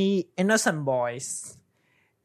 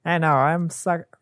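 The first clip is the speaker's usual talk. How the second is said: usual speaking voice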